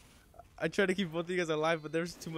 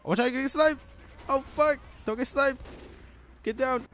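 urgent yelled warning; shouting a warning; 10 semitones higher, 6 dB louder than usual. Real, not acted. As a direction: yell it to warn a teammate. Teammate being sniped in game.

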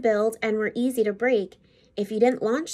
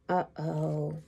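lower, softer worried sigh; dismayed uh-oh; 6 semitones lower, 6 dB quieter than usual. A narrator's reading. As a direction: let the disappointment drop.

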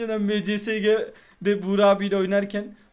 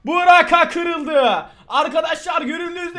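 high, loud, slow triumphant call; loud victory shout; excited shout; 7 semitones higher, 6 dB louder, slower than usual. Real, not acted. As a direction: announce it to the viewers.

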